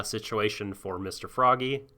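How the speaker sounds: normal talking voice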